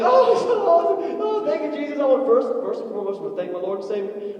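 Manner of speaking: very high, loud gushing thanks; hammed-up celebrity thanking Jesus; gushing award winner. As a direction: ham up an acceptance speech. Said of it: "they win ... and like".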